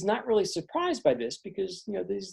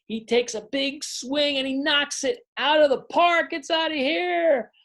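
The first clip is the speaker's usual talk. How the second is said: much higher, building to a shout; excited home-run call; 10 semitones higher than usual. A narrator's reading. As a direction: call the homer like a thrilled announcer. Big hit, play-by-play climax.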